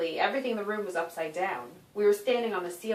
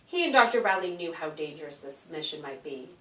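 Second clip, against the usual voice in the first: ordinary delivery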